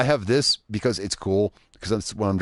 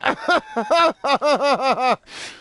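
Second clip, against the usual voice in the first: long high-pitched cackle; high squealing laugh; 3 dB louder than usual. Real, not acted. Truck sinks in water.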